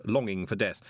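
plain reading voice

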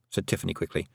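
ordinary delivery, nothing marked

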